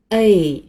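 "A" said as English ay, clear and full; 英文字母A的标准读音; English letter A. Shown as a demonstration. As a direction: model "A" as the English letter name ay. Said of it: "这个字母读作".

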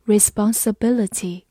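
usual speaking voice

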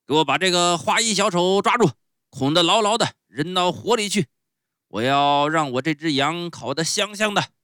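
loud, high-pitched roar of command; huffing angry order. A narrator's reading. Showman orders guards to seize the clown, furious bellow. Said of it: "气咻咻地对他们说".

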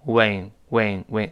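"wing" repeated slowly as the second syllable of going; isolated w glide. Shown as a demonstration. Teacher isolates the syllable for students, clear w glide. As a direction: lean into the w of "wing", softening each time. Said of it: "人为的加一个喔".

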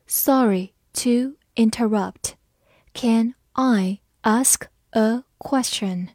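slow, word by word; slow read-along. Shown as a demonstration. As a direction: read it slowly with a pause after each word. Model sentence read slowly for learners. (逐词慢读)

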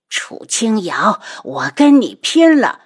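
slow then loud enraged cry; furious. Acted. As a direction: lunge at her in rage. 楚夫人扑过来拼命. (气急败坏地嘶喊)

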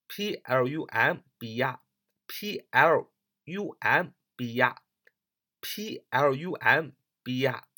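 each letter spelled out slowly; slow classroom spelling. Shown as a demonstration. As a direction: spell it out, one letter at a time. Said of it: "读作plumber".

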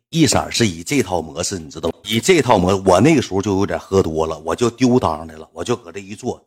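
ordinary talk

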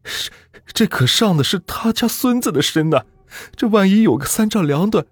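high, anxious pleading voice; frightened family member. Acted.

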